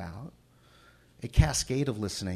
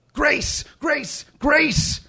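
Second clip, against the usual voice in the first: very high, loud repeated calls; calling out desperately; 10 dB louder, much slower than usual. Acted. The narrator calls again and again for Grace, very high and loud. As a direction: shout the name into the empty forest.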